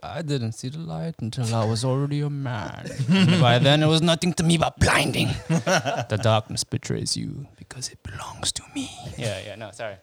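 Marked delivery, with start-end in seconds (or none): 0.0-8.9 loom over him like a masked villain; Bane impression. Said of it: "I bring up the Bane quotes".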